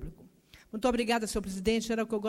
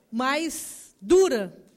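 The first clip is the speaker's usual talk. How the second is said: "mais dura" drawn out slowly and punched loud; stressed slowly; 2 semitones higher, 7 dB louder, much slower than usual. Real, not acted. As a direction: lean hard on "mais dura", slow and loud. Emphasis in a political speech.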